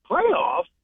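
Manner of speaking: incredulous high rising "Playoffs?" in a mild Jim Mora impression. Bettor shows how to ask for swag, mild Jim Mora disbelief. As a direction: pitch "Playoffs?" up in disbelief, half-hearted coach rant. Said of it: "you gotta say it like that".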